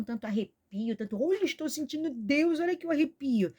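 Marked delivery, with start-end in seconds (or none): 1.2-3.5 higher, swooning mock of a thrilled believer; hammed-up imitation of an overexcited churchgoer; mocking emotional worshipper. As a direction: parody someone showing off their goosebumps. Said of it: "o que que adianta tanta emoção".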